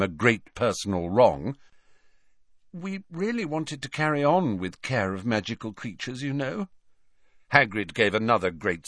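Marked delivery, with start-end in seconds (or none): 2.7-6.7 soft, higher-pitched appeal; timid Hermione. A narrator's reading. Hermione explaining to Hagrid about his class, timid. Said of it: "said Hermione timidly".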